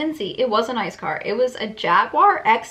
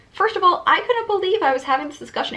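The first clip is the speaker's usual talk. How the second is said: indignant; 9 semitones higher, 4 dB louder, faster than usual. Acted. Indignant narration.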